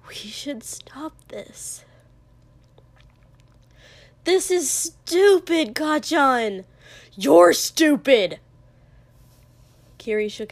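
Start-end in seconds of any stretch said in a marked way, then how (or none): 0.1-1.8 slow, very soft whisper; quivering whisper. A narrator's reading. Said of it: "Denki whispered suddenly, voice quivering a little". 4.2-8.4 loud, slow, raised-pitch outburst; angry shout. Acted. Acted shouting match during brawl.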